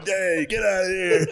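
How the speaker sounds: high-pitched call across the room; hammed-up heckling holler; mock protest. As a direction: call out in playful outrage. Comics calling out after offensive joke.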